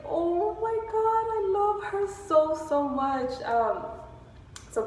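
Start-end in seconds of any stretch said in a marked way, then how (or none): 0.1-3.3 adoring gush. Retold or imitated. Stylist reacting to a child's compliment, retold adoring gush. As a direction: gush with melting affection, starting very high and easing down.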